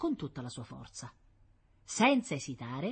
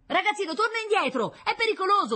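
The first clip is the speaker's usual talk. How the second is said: high, loud urgent yell; shouted warning; 9 dB louder than usual. A narrator's reading. Firemen warning a little boy of danger, shouting. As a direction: call the child back from danger. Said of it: "gridavano i pompieri".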